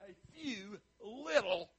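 slow, dragged-out pitiful whine; mock pitiful, drawn out. Mocking the disciples' pitiful answer, slow and dragged. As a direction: sound let down by how little there is. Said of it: "They didn't have to say it like that".

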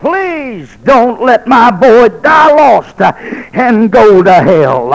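slow, high anguished cry to God; crying plea. Retold or imitated. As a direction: wail it like a mother at a deathbed. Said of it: "she was crying".

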